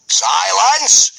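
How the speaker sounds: high, loud roar of a Viking giant. Acted. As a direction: roar for silence. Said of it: "roared Gobber the Belch".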